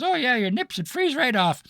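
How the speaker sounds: put-on high, loud old lady; cackling old lady quip.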